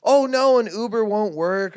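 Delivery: high, loud wailed excuse; hammed-up tearful protest, dragged out at the end; mock weeping. Drug lady refusing an Uber, wailing. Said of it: "like, weeping".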